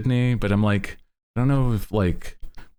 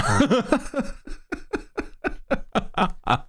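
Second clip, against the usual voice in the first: high, soft, drawn-out giggle; long giggle; 14 semitones higher, 4 dB quieter than usual. Real, not acted. Laughing after the chat's correction.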